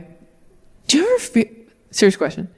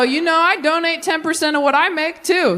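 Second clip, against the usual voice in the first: bragging; 7 semitones higher than usual. Retold or imitated. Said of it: "I go".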